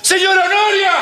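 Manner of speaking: very high, desperate call; llamando a gritos. Acted. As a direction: shriek the name to summon help. Calls out again for help. (grito agudísimo y desesperado)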